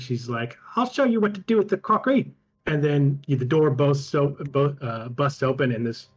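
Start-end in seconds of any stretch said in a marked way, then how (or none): 0.8-2.3 high, fast spiteful yell; furious retort. Acted. Wife snapping back at her husband, fast and high. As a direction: spit it back at him.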